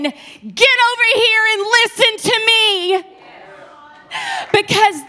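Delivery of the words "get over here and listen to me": firm loving God. Pretend. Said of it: "loving God saying".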